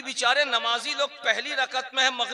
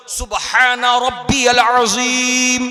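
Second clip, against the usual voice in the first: slow, loud, drawn-out chant; unhurried, composed recitation; 7 dB louder, slower than usual. Shown as a demonstration. Preacher showing how to recite in ruku, unhurried and resonant. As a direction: take your time over every syllable. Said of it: "इत्मीनान से बोलो".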